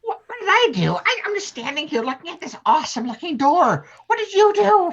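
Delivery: high, outraged denial; squeaky, defensive what did you do; indignant protest. Acted. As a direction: throw the blame back.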